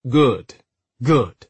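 brisk, mildly impatient approval. A narrator's reading. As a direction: brush it off with curt impatience. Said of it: "Hode said impatiently".

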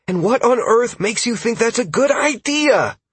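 high-pitched indignant challenge; yelling back. A narrator's reading. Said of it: "Caius yelled back".

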